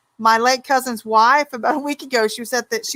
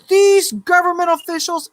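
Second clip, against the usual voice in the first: mimicking a fed-up friend; 7 semitones higher than usual. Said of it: "she goes".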